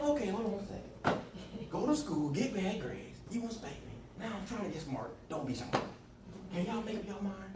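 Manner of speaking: put-on sarcastic kid. Boy pointing out mother's mixed messages, sarcastic. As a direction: argue back like a cheeky child. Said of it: "I'm really sarcastic".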